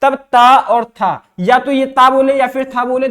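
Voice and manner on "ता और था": ता and था said slowly, one by one; slow demo of ता versus था; contrasting syllables. Shown as a demonstration. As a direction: spell out ता and then था slowly, keeping them apart. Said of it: "इन दोनों का उच्चारण".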